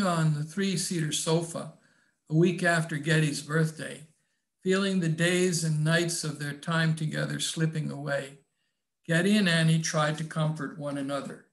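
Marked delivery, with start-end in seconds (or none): none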